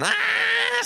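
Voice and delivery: very high, loud scream of frustration; frustrated scream. A narrator's reading. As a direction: scream in despair. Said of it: "schreit der Kleine". (verzweifelter, schriller Schrei)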